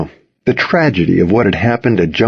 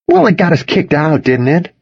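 pitched-up, self-satisfied retort; lightly smug, higher voice; 8 semitones higher than usual. A narrator's reading. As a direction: say it pleased with your own scheme. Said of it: "Toby said smugly".

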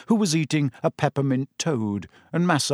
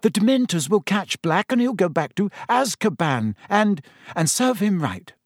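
higher-pitched, stricken friend; Hermione's voice; 7 semitones higher than usual. Acted. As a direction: insist anxiously, pitched up. Hermione, stricken, arguing with Harry.

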